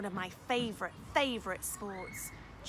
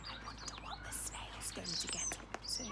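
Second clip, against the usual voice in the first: whisper; 3 semitones lower, 6 dB quieter than usual. Real, not acted. Whispered aside to children.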